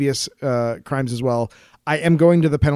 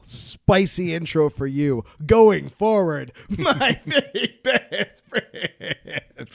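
mock menace; 3 semitones higher, much slower than usual. Mock threat to listener.

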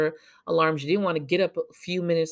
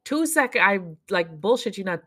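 normal talking voice

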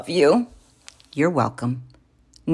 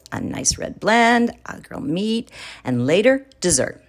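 plain reading voice

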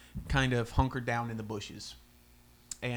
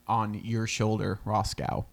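ordinary talk

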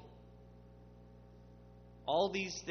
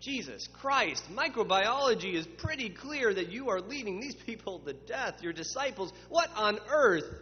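exasperated Pharisee outburst; 6 semitones higher, 4 dB louder than usual. Acted. Pharisees berating Jesus over disciples, mock outraged. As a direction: build to a mock outraged what on earth. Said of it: "When they say".

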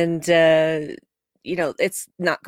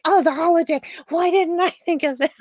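self-blame; 10 semitones higher than usual. Retold or imitated. Tendi voiced, beating herself up.

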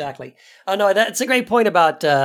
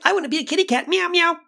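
high-pitched imitation of a child; kid playing kitty; 10 semitones higher, faster than usual. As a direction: lightly mimic a kid meowing like a kitten. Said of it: "some kids are like".

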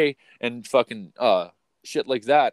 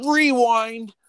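high, loud, stretched-out call; big drawn-out announcer-like shout; announced rewind; 10 semitones higher, 5 dB louder than usual. Pretend. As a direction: sing out the word like a sound cue. Announces going back in story.

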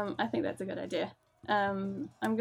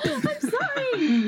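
high, loud laughing wail; laughing apology; 7 dB louder, much slower than usual. Real, not acted. Player apologising for a bad roll, laughing wail. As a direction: protest loudly through laughter, drawn out.

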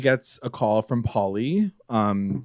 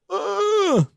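mock Betty groan. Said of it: "Betty's face being like".

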